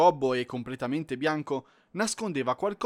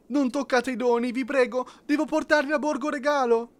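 much higher, louder plea; desperate pleading; 11 semitones higher, 5 dB louder than usual. Acted. Caged elf begs the penguins to spare the gifts, desperate plea. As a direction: beg them desperately.

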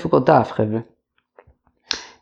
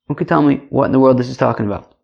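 usual speaking voice

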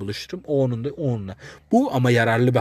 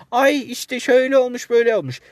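high mocking whine; mocking excuse voice; 11 semitones higher, 4 dB louder than usual. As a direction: mimic a flimsy excuse, high and whiny.

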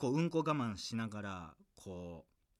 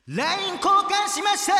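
very high, loud triumphant proclamation; shouted report; 13 dB louder than usual. Pretend. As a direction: scream the listener's news as a punchline. Shouts listener's report climax. (近況を高らかに絶叫)

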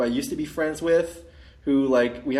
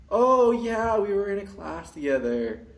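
imitating the girl; 6 semitones higher, slower than usual. Voicing the girl from class.

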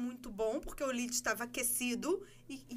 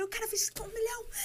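euphoric; 8 semitones higher than usual. Retold or imitated. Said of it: "entrei no carro eufórica".

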